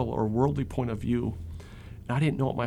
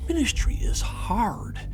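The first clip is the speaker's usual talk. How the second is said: slow whisper, pitched up; 10 semitones higher, slower than usual. Real, not acted. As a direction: breathe it out slowly. Stressing ministry's difficulty.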